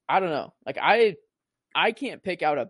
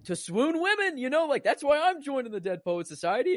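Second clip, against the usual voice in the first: very high, giddy imitation; hammed-up high-voiced eagerness; mock excitement; 11 semitones higher than usual. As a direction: play a boy thrilled at the idea, pitched way up. Mocking boy's eager takeaway from quote.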